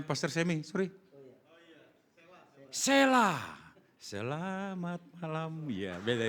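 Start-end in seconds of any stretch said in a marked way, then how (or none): 2.7-3.4 high, sharp call of the name; fierce-sounding call. Real, not acted. Preacher summoning a child in the congregation, high sharp call. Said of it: "saya enggak galak kok ngomongnya".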